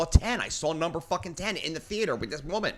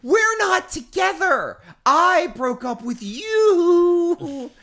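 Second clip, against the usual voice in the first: exasperated protest; 12 semitones higher, 8 dB louder, much slower than usual. Retold or imitated. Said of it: "and I'm like".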